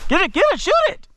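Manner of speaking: high urgent call to shoot; excited urging. Real, not acted. Urging the hunter on.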